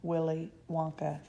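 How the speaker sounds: usual speaking voice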